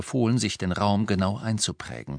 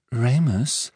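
higher, hesitant call of the name; timid; 4 semitones higher, 4 dB louder than usual. A narrator's reading. Hermine cautiously approaching Lupin, timidly. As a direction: tentatively try his name. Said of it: "sagte Hermine zaghaft".

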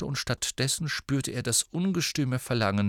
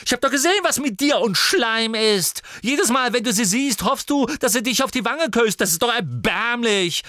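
much higher, louder, fast at the end; raging tirade; 12 semitones higher, 7 dB louder than usual. Acted. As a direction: pour contempt on him. Ginny rages at her brother.

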